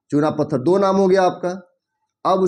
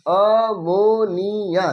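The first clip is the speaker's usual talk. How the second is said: slow dictation; 3 semitones higher, much slower than usual. Shown as a demonstration. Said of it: "teacher spells out name slowly".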